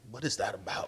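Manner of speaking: very soft, voiceless audience aside; hushed imitation of confusion; baffled murmur. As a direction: whisper it as a puzzled onlooker. Imitates puzzled audience reaction.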